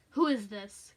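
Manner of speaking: loud, curt demand; lightly voiced menacing caller; demanding male caller. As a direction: put weight on the first word, demanding. Gruff stranger on phone, story.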